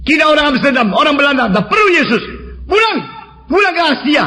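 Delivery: high, strident angry rant; imitated mad yelling. Dutch heckler telling the visitor to go back to Asia, yelling. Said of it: "Teriak-teriak, kayak orang gila".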